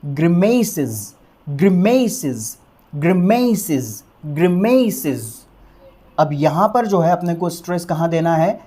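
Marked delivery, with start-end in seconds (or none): 0.0-5.5 ग्रिमेसेस said slowly, stress on the m, ending -sez; slow teacher's model of ग्रिमेसेस with a z ending; pronunciation demo. Shown as a demonstration. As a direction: drill ग्रिमेसेस slowly for the class. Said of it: "इसका pronunciation क्या होगा फिर?".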